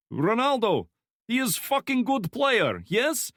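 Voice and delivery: put-on high, loud thick Slavic accent; heavy Slavic accent. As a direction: Slavic thug voice, friendly menace. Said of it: "speaking in a heavy Slavic accent".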